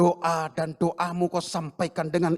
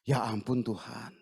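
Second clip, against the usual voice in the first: pleading sigh; 10 semitones lower, 10 dB quieter than usual. Acted. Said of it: "Ini dalam bahasa sehari-hari".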